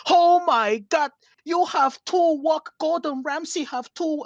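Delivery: hammed-up Uncle Roger accent, loud at the start. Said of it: "he exaggerates his accent so much".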